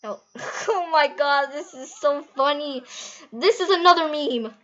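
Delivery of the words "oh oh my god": ordinary talk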